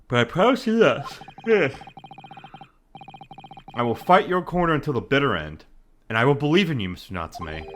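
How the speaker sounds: usual speaking voice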